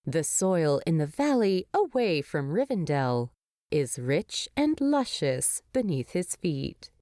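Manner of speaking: ordinary delivery, nothing marked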